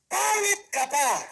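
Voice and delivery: loud, high, drawn-out jab; slow punchline insult. Acted. Comic hits the audience with the insult: loud, high, drawn out. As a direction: stretch each word out like a slap.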